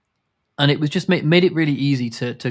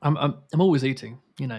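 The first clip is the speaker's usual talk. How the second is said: ordinary talk, nothing marked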